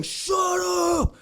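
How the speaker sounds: high, stretched-out shout; mock angry yell. Angry man running full speed at her, imitated yell. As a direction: imitate him yelling mid-charge. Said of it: "comes running over yelling that".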